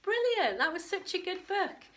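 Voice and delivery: excited. Real, not acted. Reader delighted after story.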